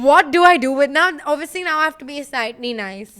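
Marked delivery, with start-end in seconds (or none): 0.0-1.1 high, loud baffled laugh; cracking up in disbelief; stunned and laughing at the compliment; incredulous laugh. Retold or imitated. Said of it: "And I was like".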